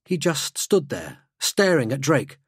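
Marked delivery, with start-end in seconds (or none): none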